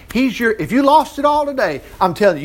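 ordinary talk, nothing marked

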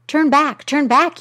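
high, loud warning cry; bird crying out. Acted. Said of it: "And it cried out".

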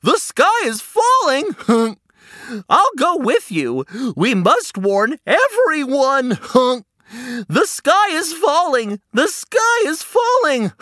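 a goose caught up in the panic; alarmed goose. Acted. Goose joins Henny's alarm.

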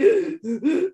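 a sharp, high mock gasp for air; imitated heaving gasp. Showing listeners her hyperventilating heave, a mock gasp. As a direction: act out the sob heave she had. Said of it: "like, heaving".

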